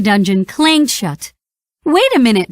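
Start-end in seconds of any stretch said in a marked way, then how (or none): 1.8-2.5 high, startled squawk; Donald crying out. A narrator's reading. Donald realises the prince is captured too, alarmed. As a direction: stop short in alarm. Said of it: "Donald cried".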